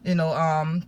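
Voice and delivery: normal talking voice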